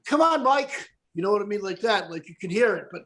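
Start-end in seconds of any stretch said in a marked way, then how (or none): 0.1-0.9 imitated Irish brogue, pitched up. Guest mimicking an Irishman from church group, lilting brogue. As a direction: call out in a put-on Irish brogue. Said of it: "broke, like".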